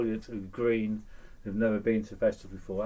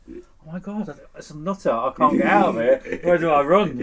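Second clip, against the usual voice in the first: retold alarm, rising in volume; mock panic; 5 semitones higher, 5 dB louder than usual. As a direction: play up being cornered by a nutter. His alarmed reaction in the story.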